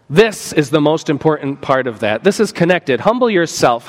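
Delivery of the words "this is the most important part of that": ordinary talk, nothing marked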